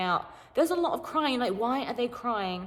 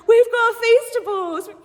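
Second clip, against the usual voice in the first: slow, much higher and louder overexcited yell; hyped shout; 13 semitones higher, 11 dB louder, slower than usual. Retold or imitated. Sleep-deprived contestant yelling for the cameras, hyped. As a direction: shout it like a sugar-crazed cheerleader. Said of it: "I was saying the dumbest stuff".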